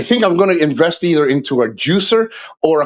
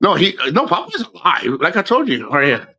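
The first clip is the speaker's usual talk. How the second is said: usual speaking voice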